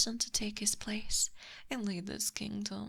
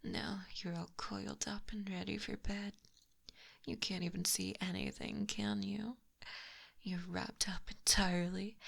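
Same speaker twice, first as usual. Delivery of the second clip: ordinary talk, nothing marked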